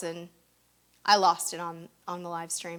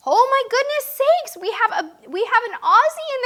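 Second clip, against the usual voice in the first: excited; 11 semitones higher, 8 dB louder than usual. Real, not acted. Excited super chat arrival.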